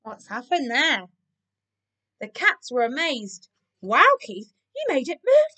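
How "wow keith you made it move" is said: loud wow leaping up high; gasped amazement. A narrator's reading. The amazed cats to Keith, gasping. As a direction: marvel at it in astonishment. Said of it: "they gasped".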